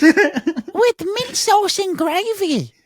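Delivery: high, slow mock British lady accent; a silly falsetto British lady accent; crazy British lady accent. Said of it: "my crazy British lady accent".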